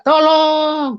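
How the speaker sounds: high, drawn-out "help" call; retold cry for help. Injured man calling out for rescue, retold cry. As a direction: re-enact a long pleading call. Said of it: "lalu saya berteriak".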